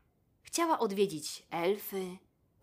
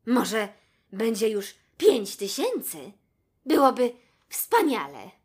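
dreamy delight; 4 semitones higher, 9 dB louder than usual. A narrator's reading. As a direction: savour the thought, then swell with joy. Giraffe dreams of her spots. (rozmarzony, głośniejszy zachwyt żyrafy)

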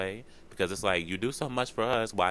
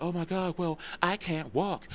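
worried whine; 10 semitones higher than usual. Retold or imitated. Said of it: "and it's like".

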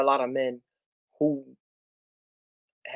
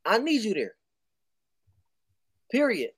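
higher-pitched, insistent plea; emphatic recruiting pitch, raised in pitch; urgent appeal; 7 semitones higher than usual. Acted. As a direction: personally urge a friend to show up. Acted phone appeal to men.

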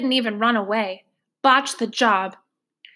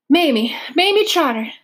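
higher and louder, flustered, out of breath; breathless arrival; 5 semitones higher, 4 dB louder than usual. Acted. Trotter arriving flustered at the station, out of breath. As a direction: gasp her name out in a rush.

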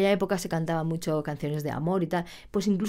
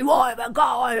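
raised, higher mock rock shout; sudden acted rock yell; rocker outburst; 5 semitones higher, 4 dB louder than usual. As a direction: belt it out like a brash rocker. Acts out shy student turned rocker.

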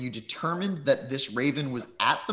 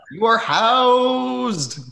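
grand announcement; 11 semitones higher, 9 dB louder, much slower than usual. Acted. Announcing the players are housed.

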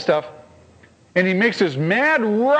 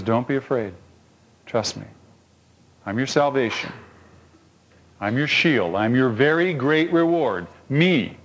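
God reassuring; 5 semitones lower than usual. Retold or imitated. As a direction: comfort them like a calm father. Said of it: "God comes and says".

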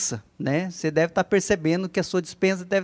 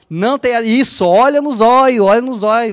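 louder, urging them on; to someone hard of hearing; 4 semitones higher, 7 dB louder than usual. Shown as a demonstration. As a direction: call it out so they can hear. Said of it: "eu não tô ouvindo aqui". (mais alto, incentivando)

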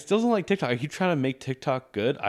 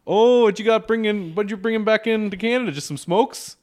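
high loud Minnesotan chatter; nosy Minnesota border guard; Minnesotan accent; 9 semitones higher, 7 dB louder than usual. Pretend. Guard quizzing about smokes, loud high Minnesotan accent. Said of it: "it's very Minnesotan".

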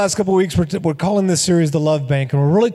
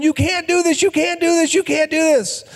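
high, panicked protest repeated; mock panic, much higher; frantic pleading; 11 semitones higher than usual. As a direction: beg him to stop, pitch way up. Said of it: "Mickey Mantle's going".

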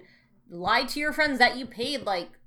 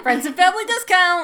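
excited; 8 semitones higher, 10 dB louder than usual. Real, not acted. Excited shout of shared memory.

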